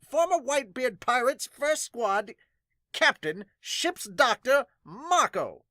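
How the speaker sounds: put-on high, loud kid proudly announcing; a small child shouting out a grand title; child announcer voice. Kid announcing Marco to the crowd, high and loud.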